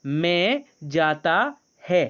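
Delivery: drawn-out wrong sentence; slow, deliberate repeat. Shown as a demonstration. Teacher repeating the wrong Hindi sentence slowly. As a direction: stretch each word so the class hears it. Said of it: "क्या बोला?".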